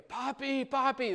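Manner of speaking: put-on high child's voice calling Papi from bed. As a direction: call Papi like a kid in the night. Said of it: "They're crying out".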